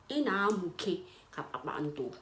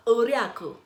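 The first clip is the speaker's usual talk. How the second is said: laughing; 4 semitones higher, 5 dB louder than usual. Real, not acted. Laughing at the pet name.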